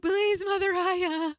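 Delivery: high, loud, slow acted cry; desperate begging. Begging Mother Aya in the retelling, desperate cry. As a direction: wail it out as a plea.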